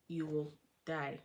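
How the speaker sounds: normal talking voice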